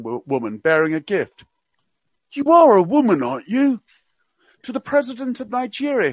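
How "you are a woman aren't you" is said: higher, hammed-up Cockney accent; Prince Philip in Cockney. Radio guest quoting royal gaffes to listeners, mock Cockney accent. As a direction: play the old duke's gaffes in mock Cockney. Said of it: "he spoke with a Cockney accent".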